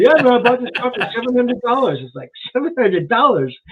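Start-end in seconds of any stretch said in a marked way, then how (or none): 0.1-2.0 high-pitched imitation of a proud boast; proud car owner. Guest mimics [name] bragging about his car, proud. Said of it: "but he's proud of it". 2.5-3.6 higher-pitched echo in disbelief; incredulous. Retold or imitated. Guest inwardly reacts to the car's price, incredulous. Mocks the boasted car price.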